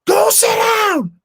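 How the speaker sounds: very high, drawn-out mock shout; imitated shrieking parent; two octaves up. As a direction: screech the order in exaggerated falsetto. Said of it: "like, two octaves".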